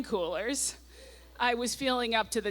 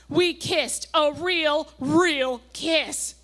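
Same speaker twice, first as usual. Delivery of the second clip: loud, slow, and higher, as if in capital letters; all caps; 6 semitones higher, 5 dB louder, much slower than usual. Retold or imitated. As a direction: shout the diary's capitals out slowly. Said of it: "All caps".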